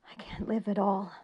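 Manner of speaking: despairing whisper; breathed. A narrator's reading. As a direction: barely breathe the words out. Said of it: "he breathed".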